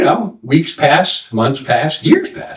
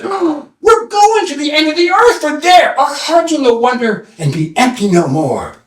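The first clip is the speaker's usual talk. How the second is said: calling out; 11 semitones higher than usual. Acted. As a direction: stretch out the grand answer, starting high. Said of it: "they're calling something".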